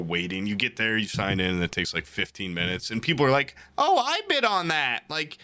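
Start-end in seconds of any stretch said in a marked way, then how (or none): 3.8-5.4 high-pitched mock small talk; chatty fellow bidders. Imitating bidders chatting while they wait, high mock chatty voice. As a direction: imitate gushing strangers, pitched well up. Voicing people chatting in the queue.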